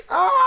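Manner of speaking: high, loud mock scream of pain. Friend imitating the victim shot in the toe, high mock scream.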